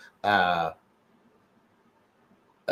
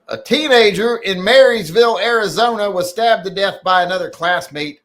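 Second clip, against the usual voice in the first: raised, louder news read; headline read; 9 semitones higher, 6 dB louder than usual. Acted. Host reading a news story from chat, raised news read. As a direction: deliver it like a news anchor.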